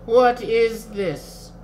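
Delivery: slow, high, drawn-out wonder; expressive hush. A narrator's reading. Old wizard leans toward the youth, slow and expressive. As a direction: ask slowly with mock-dramatic awe. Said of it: "he whispered expressively".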